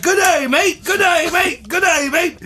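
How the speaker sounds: high squeaky Australian dog voice; dog saying good day mate. Acted. Dog trying to warn his owner, high yappy voice. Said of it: "And he's like".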